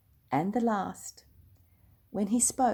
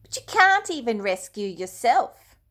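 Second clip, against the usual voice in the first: louder jeering taunt; a mocking crowd shouts up at the cross; 4 semitones higher, 7 dB louder than usual. A narrator's reading. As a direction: throw the taunt at him. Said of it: "people shouted".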